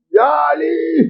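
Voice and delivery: high, drawn-out call. Retold or imitated. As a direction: hail Ali from among the congregation. Man stands and calls Ali.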